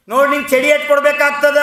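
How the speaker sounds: loud, high, quick scolding; loud threat. A narrator's reading. Teacher threatens a silent pupil with the cane, loud. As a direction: threaten the pupil at full voice, fast. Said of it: "ಮತ್ತು ಜೋರಾಗಿ ಆ ಮಗು ಹೇಳಿದ್ಲು".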